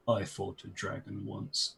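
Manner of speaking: low flat deadpan giant; slow monotone voice. Acted. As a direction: drone it out slowly, no inflection. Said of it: "kind of says in a slow, monotone voice".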